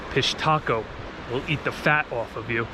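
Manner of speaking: ordinary delivery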